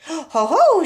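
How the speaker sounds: loud, high triumphant ho ho; gleeful cry. A narrator's reading. Grandma crowing over George's cup, loud and gleeful. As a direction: crow it out as if catching him. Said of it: "she cried".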